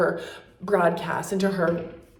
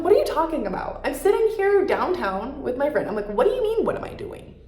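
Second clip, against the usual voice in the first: high-pitched mock disbelief, climbing higher; indignant retort; 7 semitones higher than usual. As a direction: retell it as an offended, baffled comeback. Retold indignant reply to the man.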